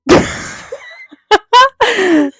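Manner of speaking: shrieking laugh out loud; big high cackle; loud burst of laughter. Real, not acted. Laughing at her sound.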